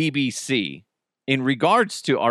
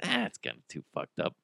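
low muttered aside; 10 semitones lower, 11 dB quieter, faster than usual. Retold or imitated. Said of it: "but I was like".

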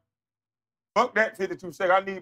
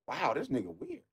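much softer, under the breath; muttered aside; 15 dB quieter than usual. Retold or imitated. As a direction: mutter it to yourself, unimpressed. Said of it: "I'm like".

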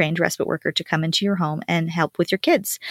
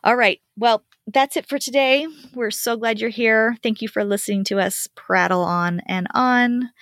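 cheery sign-off; 5 semitones higher than usual. Acted. Podcast sign-off.

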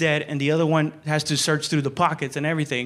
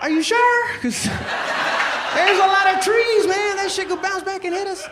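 high, raised voice of comic doubt; mock worried yelling; 12 semitones higher than usual. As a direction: call back your worries over the gunfire. Yelling back doubts during firefight.

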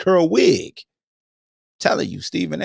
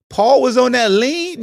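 higher and louder, stress on Paul; shocked disbelief; 7 semitones higher, 4 dB louder than usual. Acted. Hearing news about Paul, louder in disbelief.

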